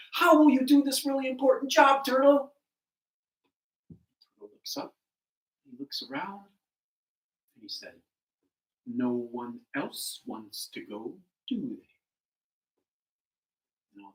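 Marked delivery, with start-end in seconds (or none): 0.1-2.5 high, loud demanding question; incredulous challenge. Acted. Animals challenge turtle incredulously. 8.9-11.9 slow turtle. Acted. Turtle answers the doubting animals, low and unhurried.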